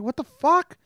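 high, startled what the fuck; shocked outburst. Retold or imitated. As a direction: blurt it out in disbelief. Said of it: "I was like".

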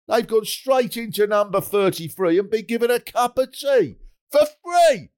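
high, outraged grumbling rant; mock indignant resident. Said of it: "we should say".

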